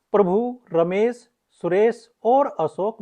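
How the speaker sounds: slow list with "Prabhu", "Ramesh", "Suresh" each set apart; short comma pauses. Shown as a demonstration. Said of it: "थोड़ा रुकना हो". ("प्रभु", "रमेश", "सुरेश" के बीच अल्प विराम)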